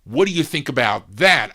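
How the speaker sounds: mock a patronizing question, punched hard; condescending. Staff mimicking how the consultant asks his question, condescending. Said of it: "you don't say it like that. You say".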